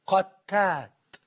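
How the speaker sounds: قتات drawn out very slowly, doubled t with fatha; careful pronunciation. Shown as a demonstration. Preacher teaching how قتات is said, very slow and precise. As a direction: stretch قتات, stress the doubled t and the open a. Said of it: "قتات بالتشديد مع الفتح على التاء".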